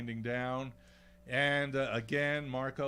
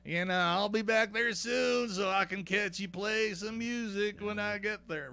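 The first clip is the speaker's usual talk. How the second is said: imitating Rick; 9 semitones higher, 5 dB louder than usual. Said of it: "He's going".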